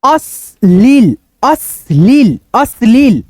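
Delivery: अश्लील in two beats, अश्-लील, first take slow; syllable-by-syllable reading. Shown as a demonstration. Teacher showing the word to students, अश्लील split into two beats. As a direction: hold अश् then a falling लील in अश्लील. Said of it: "यहाँ पे देखो".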